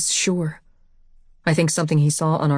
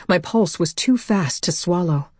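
usual speaking voice

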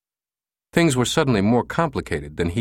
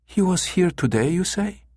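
much higher female receptionist; pleasant female voice; 10 semitones higher than usual. A narrator's reading. Receptionist checking on client's brother, pleasant female voice. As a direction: question the caller in a woman's voice. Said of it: "a pleasant female voice".

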